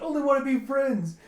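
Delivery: high, loud mock wail; panicked protest. Jeha dashing off from Sinha, panicked protest. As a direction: cry it out as if being chased. Acted protest while fleeing.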